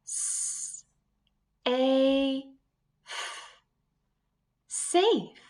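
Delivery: long A held, then safe said high; sounding out safe. Shown as a demonstration. Teacher flipping the vowel for the class, blended slowly. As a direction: sound it out letter by letter. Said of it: "Let's flip the vowel sound".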